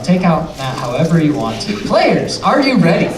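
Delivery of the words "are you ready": normal talking voice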